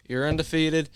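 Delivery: ordinary talk, nothing marked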